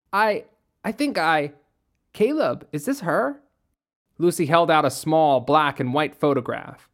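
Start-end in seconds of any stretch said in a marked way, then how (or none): 0.1-3.4 higher, stammering excited squeal. Acted. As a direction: squeal with breathless discovery. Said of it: "Lucy squealed".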